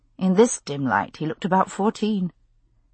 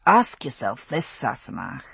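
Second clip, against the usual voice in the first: Scottish man voice; 5 semitones lower than usual. A narrator's reading. Jamie voiced by narrator.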